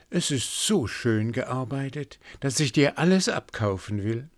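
the queen, charmed, wants to buy it all; lightly voiced delighted queen. A narrator's reading. Queen to the servant, lightly voiced delight.

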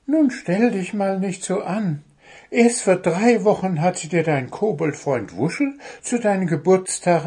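high, brisk bird voice; high bird voice, coaxing; chiding little bird. Acted. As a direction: tell the gnome to stop fussing. Bird scolds the gnome.

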